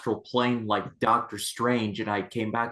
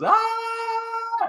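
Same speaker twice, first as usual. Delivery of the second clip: hammed-up terrified priest scream; mock scream. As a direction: shriek as a spear falls on you. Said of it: "and goes".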